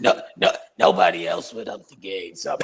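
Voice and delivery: put-on strained, shouted old man; old umpire voice. Answering as the dead umpire.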